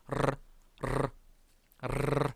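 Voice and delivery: "르" as sustained tongue trills; rolled R. Shown as a demonstration. Teacher asking students to try it now, rolled R. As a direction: trill "르" three times with gaps. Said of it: "이렇게".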